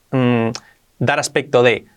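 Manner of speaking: normal talking voice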